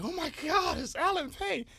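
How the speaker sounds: high-pitched mock swooning; starstruck ladies. Ladies spotting an actor at Fridays, mock starstruck. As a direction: imitate excited fans in a high voice.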